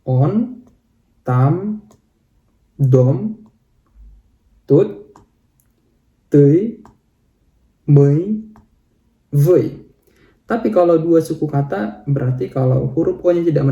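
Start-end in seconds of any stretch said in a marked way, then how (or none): 0.0-9.6 on, tam, dom, tut, tui, mei, voi read one by one; one-syllable word demo. Shown as a demonstration. Teacher reading one-syllable words aloud, one at a time. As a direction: read each short word with pauses between. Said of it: "Kita baca".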